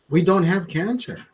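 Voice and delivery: ordinary delivery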